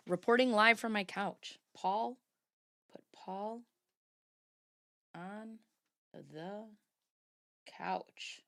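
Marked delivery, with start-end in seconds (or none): 1.7-8.4 very soft, halting murmur to oneself; quiet self-talk. Real, not acted. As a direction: murmur it word by word, fading to a whisper. Talking to self while noting.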